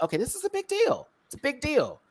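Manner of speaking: excited. Retold or imitated. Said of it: "but it was like".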